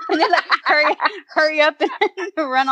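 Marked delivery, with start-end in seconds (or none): none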